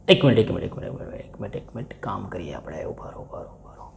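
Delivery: trailing into a whispered mutter to himself; hushed, thinking aloud under his breath. Real, not acted. Teacher mutters to himself while checking his own working, whispering.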